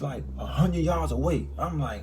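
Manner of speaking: ordinary delivery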